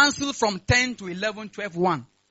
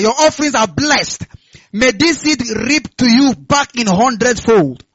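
loud, emphatic offering blessing; forceful declarations, driving louder on the promises; full-force prayer; 8 dB louder than usual. Real, not acted. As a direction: preach the blessing out at full volume. Prays over lifted offerings.

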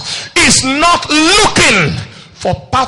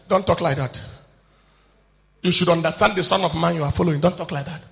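gentle hushed rebuke; 8 semitones lower, 8 dB quieter, faster than usual. Acted. Jesus gently rebukes disciples.

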